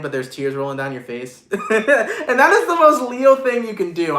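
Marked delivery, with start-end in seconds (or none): 1.7-4.2 laughing. Real, not acted. Laughing at Leo habit.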